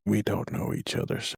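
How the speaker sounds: whispered, soft aside. Acted. Player aside in character.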